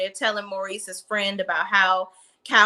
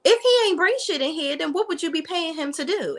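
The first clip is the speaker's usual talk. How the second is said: sassy retort; 8 semitones higher, faster than usual. Retold or imitated. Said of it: "I'm like".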